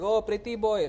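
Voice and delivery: put-on Italian accent. Women greeting him at the brothel, mock Italian accent. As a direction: imitate a cooing Italian accent. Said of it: "most Italian accent".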